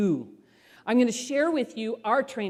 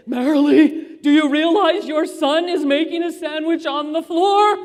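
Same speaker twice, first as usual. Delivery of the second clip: shocked visitor; 7 semitones higher, 9 dB louder than usual. Retold or imitated. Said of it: "the one woman said".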